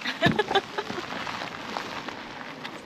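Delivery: soft unvoiced giggle; breathy giggle. Real, not acted. Giggling at the snow.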